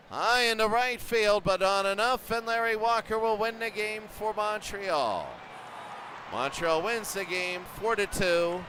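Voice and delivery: loud, high, rising home run call; excited play-by-play call. Real, not acted. Broadcaster calls the game-winning hit for Montreal, excited and loud. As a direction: ride the fly ball to right all the way home.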